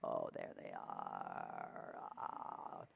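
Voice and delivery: deep, slow, soft voice; very low and slow. Shown as a demonstration. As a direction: drop right down and drag it. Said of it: "you sing with the breath".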